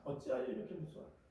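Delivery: hushed, disappointed rebuke; soft rebuke. Retold or imitated. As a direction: ask it quietly, almost to yourself. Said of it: "이렇게 책망하셨어요".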